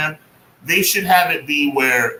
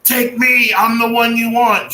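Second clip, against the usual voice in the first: high, loud mock heroic plea; 9 semitones higher, 6 dB louder, slower than usual. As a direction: ham up a heroic sacrifice line. Mocking hero line.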